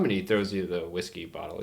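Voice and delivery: ordinary talk, nothing marked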